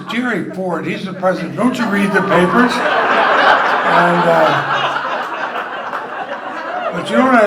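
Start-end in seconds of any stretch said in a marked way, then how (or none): 0.1-2.7 louder, higher retold exasperation; mock incredulous. Astonished colleague answers "Jerry who?", incredulous. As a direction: raise your voice as if it's obvious.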